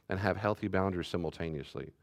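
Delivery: ordinary talk, nothing marked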